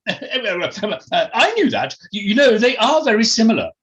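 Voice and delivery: high, loud, flustered posh voice; Felicity Forsyth Twike, defensive. Acted. Posh lady corrected on the breed, defensive. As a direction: splutter and protest to save face. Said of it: "now sounded a little defensive".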